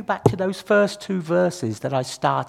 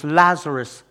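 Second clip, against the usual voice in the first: Jesus calling loudly into the tomb, loud call; loud cry; 9 dB louder than usual. A narrator's reading. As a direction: call the name out loud and carrying. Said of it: "he cried out in a loud voice".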